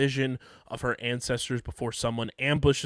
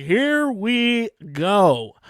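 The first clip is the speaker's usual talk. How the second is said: much higher, louder, very slow; gleeful anticipation; 9 semitones higher, 8 dB louder, much slower than usual. Retold or imitated. Host recalls watching the fight begin, gleeful. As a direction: savor it like the fun is starting. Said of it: "I was like".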